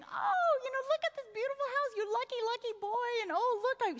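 swooning high-pitched gush over the house; gushing society mother. Retold or imitated. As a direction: fawn over the lucky boy like a doting hostess. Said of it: "she starts saying".

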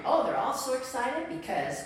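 ordinary delivery

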